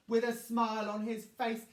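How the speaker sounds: ordinary delivery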